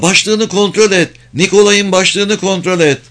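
high-pitched, pressing command; urgent shout. A narrator's reading. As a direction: call out an urgent order, pitched well up. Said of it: "diye bağırdı Emma".

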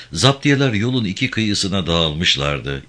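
plain reading voice

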